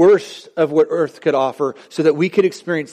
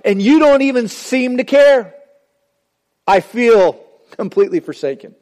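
desperate plea; 5 semitones higher than usual. Retold or imitated. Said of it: "The question is".

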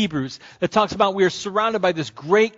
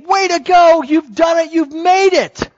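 shouted high and loud; cheering crowd greeting the finishing runner; 10 semitones higher, 8 dB louder than usual. Retold or imitated. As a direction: yell it like a roaring stadium crowd.

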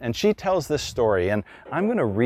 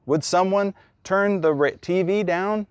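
raised, higher repeat of the request; higher-pitched, louder plea to the family; a little louder; 8 semitones higher than usual. Retold or imitated. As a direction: call it out over the TV. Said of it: "a little louder".

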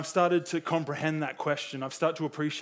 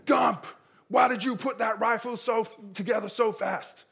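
high-pitched, raised drill-sergeant voice; 6 semitones higher than usual. Acted. Drill sergeant questioning Gump over his rifle, high-pitched military shout. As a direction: demand it like an army drill sergeant. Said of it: "the drill sergeant comes back and he goes".

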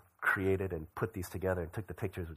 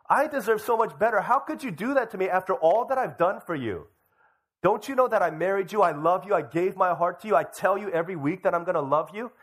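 acted wounded husband, high and loud; indignant outburst; 9 semitones higher, 6 dB louder than usual. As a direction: protest in outraged disbelief at being abandoned. Acts wronged husband confronting wife.

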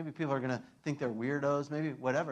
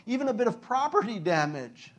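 ordinary delivery

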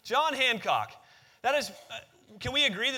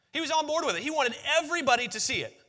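excited emphasis; 5 semitones higher, faster than usual. Real, not acted. Pastor stressing to the church that Hancock wanted everyone to see, excited. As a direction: pitch jumps higher on "He was on board with it".

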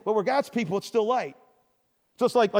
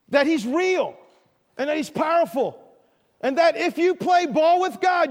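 fiery preaching; 4 semitones higher than usual. Real, not acted. Preaching at full force.

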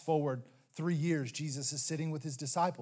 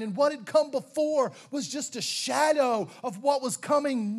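preacher voice, raised and louder; full-force preaching; 7 semitones higher, 6 dB louder, slower than usual. Acted. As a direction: drive the point home from the pulpit.

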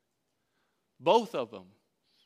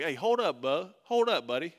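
firm check; 5 dB louder than usual. Retold or imitated. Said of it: "I'd be like".

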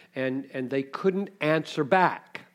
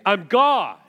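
louder, higher "I'm God" declared; divine rebuke; 7 semitones higher, 11 dB louder than usual. Acted. God answering a doubting question, loud rebuke. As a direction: proclaim it with full authority. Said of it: "he will say".

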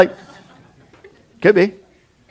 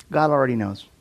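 ordinary talk

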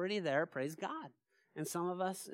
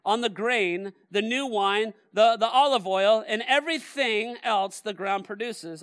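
loud, high prophetic proclamation; preaching at full force; 4 semitones higher, 10 dB louder than usual. Acted. Pastor to congregation, preaching the verses at full force. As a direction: thunder the scripture at the pews.

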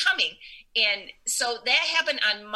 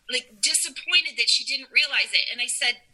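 ordinary talk

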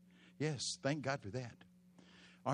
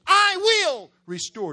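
high, loud, slow proclamation; screaming; 16 dB louder, slower than usual. Acted. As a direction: scream it out as the Lord.

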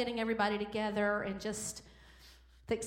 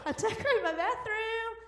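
laughing; 11 semitones higher, 3 dB louder than usual. Real, not acted. Laughing at her own decorating.

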